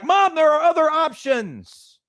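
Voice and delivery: high, slow, drawn-out mock plea; exasperated son. Son to worried mom, high and slow exasperation.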